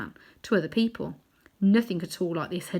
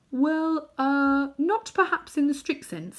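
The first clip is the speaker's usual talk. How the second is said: high, hesitant, fumbling reply; stammering; 8 semitones higher, slower than usual. A narrator's reading. Uncle Andrew stammering to the witch queen, high and unsure. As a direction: stumble over the words. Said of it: "stammered Uncle Andrew".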